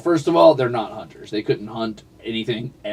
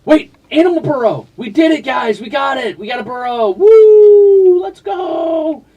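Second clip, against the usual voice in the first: much higher and louder victory shout; excited cheer; 10 dB louder, slower than usual. Real, not acted. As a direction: whoop it up like you just won. Unlocks burrow, celebrates to chat.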